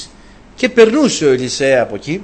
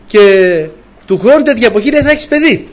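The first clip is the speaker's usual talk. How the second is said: higher and louder, proclaimed; emphatic promise; 6 semitones higher, 6 dB louder than usual. Acted. Elisha promising the woman a child, loud and high. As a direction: ring out the prophecy.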